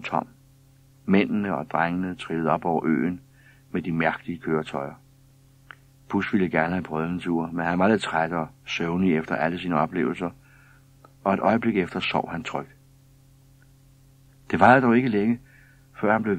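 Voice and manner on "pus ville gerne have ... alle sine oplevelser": ordinary delivery, nothing marked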